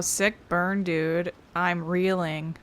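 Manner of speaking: ordinary talk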